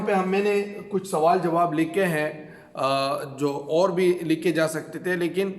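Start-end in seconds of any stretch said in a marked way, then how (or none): none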